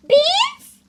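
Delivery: very high, loud sing-song call; high dog-calling voice. Real, not acted. Calling her dog with a high, loud beans call. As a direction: coax the puppy to come.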